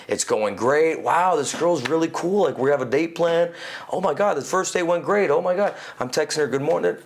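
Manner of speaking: high, eager excitement; excited. Retold or imitated. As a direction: gush about a great date. Acting out dating excitement.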